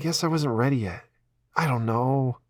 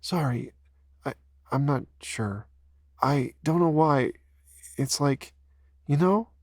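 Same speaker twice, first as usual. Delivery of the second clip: crying Ella; 7 semitones higher than usual. Acted. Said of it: "why am I crying?".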